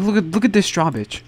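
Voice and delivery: usual speaking voice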